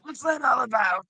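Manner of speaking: high, laughing stand-up bit; stand-up comic. Acted. Gum doing a stand-up gig, a high hammed-up comic bit. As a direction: do a cheesy observational comic. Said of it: "[name] doing a stand-up gig".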